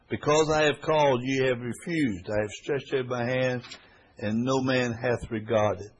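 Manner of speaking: loud and slow, weighty reproach; solemn scripture reading. Acted. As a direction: drag the words out with weight. Reads scripture aloud.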